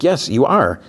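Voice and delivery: high-pitched mock bickering; childish contradiction. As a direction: argue back like a kid, lightly acted. Acts out childish back-and-forth quarrel.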